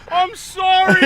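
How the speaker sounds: high, loud mock pleading; re-enacted desperate apology. Caught man apologising to the property owner, hammed-up pleading shout.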